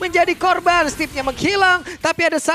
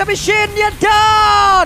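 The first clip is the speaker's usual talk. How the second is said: excited shout; 6 semitones higher, 6 dB louder, much slower than usual. Real, not acted. Caster hypes a big kill.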